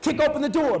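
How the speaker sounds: loud, high shouted action. Retold or imitated. Kid storming out of class, shouting. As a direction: yell it mid-rampage.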